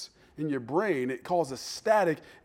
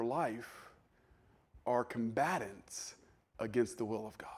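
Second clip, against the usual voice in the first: whisper; 7 semitones lower, 11 dB quieter, slower than usual. Real, not acted. Sermon drops to a hush.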